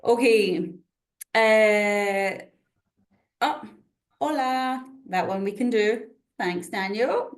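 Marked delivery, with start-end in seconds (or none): none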